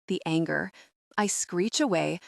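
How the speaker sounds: plain reading voice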